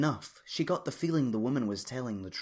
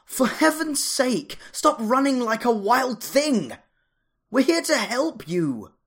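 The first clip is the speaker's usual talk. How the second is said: loud high shout, slowed and insistent; yelling; 12 dB louder than usual. A narrator's reading. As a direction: shout after a fleeing woman, high and loud. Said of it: "yelled a voice".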